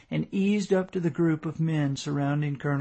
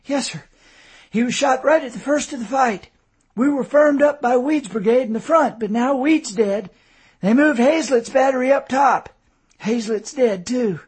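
higher and louder, breathless urgency; urgent report; 9 semitones higher, 6 dB louder than usual. A narrator's reading. Sergeant reports officers dead to the colonel, urgent and high. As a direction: rattle off the bad news urgently.

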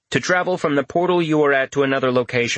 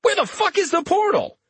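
high-pitched disbelieving demand; stunned outburst. Retold or imitated. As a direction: blurt it out in shock. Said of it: "spluttering for a few seconds".

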